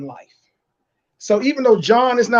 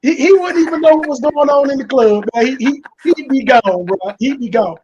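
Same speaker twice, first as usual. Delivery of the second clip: excited; 6 semitones higher, 7 dB louder than usual. Real, not acted. Excited teasing.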